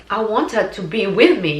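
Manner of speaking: usual speaking voice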